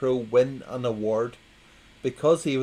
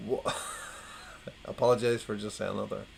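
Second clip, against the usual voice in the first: usual speaking voice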